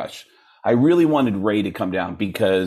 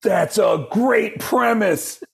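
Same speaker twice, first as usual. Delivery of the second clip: high, slow, over-the-top frontman voice; 11 semitones higher, much slower than usual. Retold or imitated. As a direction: stretch it out like a stage announcement. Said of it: "do your impression of me".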